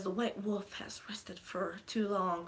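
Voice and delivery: slow, soft, half-whispered quote; lightly imitated solemn line. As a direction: lightly mimic a hushed, grave film line.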